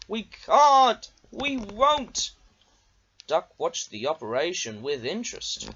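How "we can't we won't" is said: high, loud defiant chorus; stubborn trucks. A narrator's reading. Trucks defying Diesel, high and loud. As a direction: dig in and refuse.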